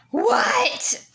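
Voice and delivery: high-pitched loud "What?"; loud call. Retold or imitated. Older sibling answering a call from sister, calling out loudly. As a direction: call it out high to someone elsewhere.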